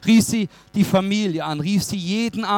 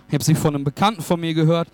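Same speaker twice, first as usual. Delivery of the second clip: ordinary talk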